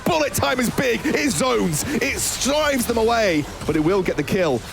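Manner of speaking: esports caster. Acted. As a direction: call the dive with building hype. Teamfight play-by-play call.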